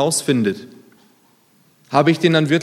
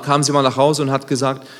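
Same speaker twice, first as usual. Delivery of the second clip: ordinary talk, nothing marked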